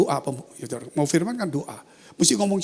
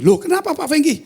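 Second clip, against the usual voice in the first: high, loud startled question; acted startled phone reply; surprised colleague; 11 semitones higher, 6 dB louder than usual. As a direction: ask in sudden surprise, pitch leaping up. Pastor on phone reacts surprised.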